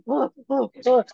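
high, repeated wordless gasps; mock gasping in place of speech; imitated startled gasps. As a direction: mimic an alarmed man who can't get words out. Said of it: "he just keeps doing this".

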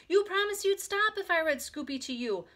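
high, loud protest; crying out. A narrator's reading. Big sister holds child to promise, crying out. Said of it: "cried Beezus".

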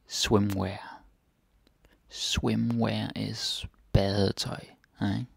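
ordinary delivery

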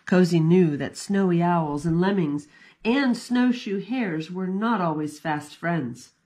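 plain reading voice

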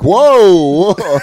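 loud, high, drawn-out whoa; hammed-up alarm at a line gone too far; mock shock. Reacting to a friend's dark insult, mock shocked shout.

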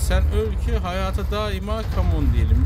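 loud, revved-up battle cry; loud, fired-up taunting; rousing taunt. Real, not acted. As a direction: shout it like a gamer mid-fight. Taunts the creature while attacking it.

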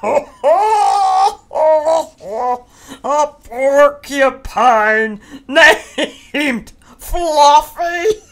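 loud, slow words through big laughs; howling with laughter. Acted. Rhinoceros howls with laughter at the name Fluffy. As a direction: roar it out, laughing helplessly. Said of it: "howled the rhinoceros".

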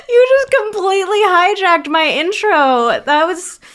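high-pitched, laughing protest; cracking up. Real, not acted. Co-host thrown by his silly voice, cracking up. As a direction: talk through helpless laughter.

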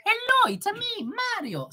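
squeaky Italian plumber hello; Mario voice. Retold or imitated. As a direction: pop up the pitch like the game hero. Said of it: "The first one was".

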